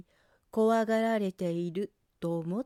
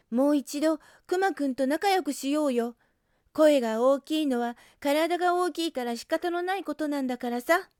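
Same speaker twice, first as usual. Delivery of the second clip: higher and louder, urging everyone; earnest pleading; 6 semitones higher, 6 dB louder than usual. Acted. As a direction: plead hard with the whole group. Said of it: "うさぎさんは一生懸命話しました". (一生懸命にみんなを説得する声)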